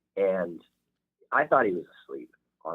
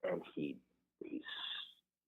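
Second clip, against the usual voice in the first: ordinary talk, nothing marked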